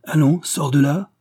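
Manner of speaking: loud acted summons; insistent order. A narrator's reading. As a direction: call him out impatiently. Réitéra le shérif.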